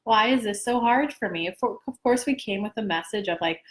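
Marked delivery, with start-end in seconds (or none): none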